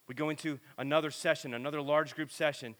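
normal talking voice

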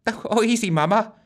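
high, whiny moan. A narrator's reading. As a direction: whimper it out in a high voice. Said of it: "she moaned".

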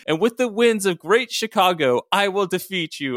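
high, grand, theatrical vow; dramatic declaration. Acted. Storm threatening Ryan before the tornado, dramatic high declaration. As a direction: declaim it like a superhero villain. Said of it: "[name] said dramatically".

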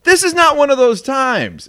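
loud, high-pitched insistence; emphatic exasperation. Real, not acted. Host ranting about the name controversy, emphatic and exasperated. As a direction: raise pitch and volume to drive the point home. Rant against radio host's stance.

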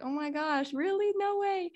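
high, squealed disbelief; mock surprise. Mock surprise at a fan's comment. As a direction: gasp it out like hearing big news. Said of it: "And I was like".

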